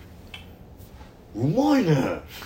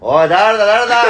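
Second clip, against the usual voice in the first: loud, high, hammed-up interrogation; mock angry demand; 7 semitones higher, 8 dB louder than usual. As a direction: demand the culprit in playful outrage. 教師風に犯人を問いただす寸劇. (怒ったふりで大声で問いただす)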